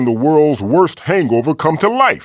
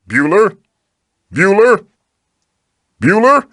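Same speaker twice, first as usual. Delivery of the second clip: calling out Bueller; 8 semitones higher, 4 dB louder than usual. Acted. Calling an absent player, each higher.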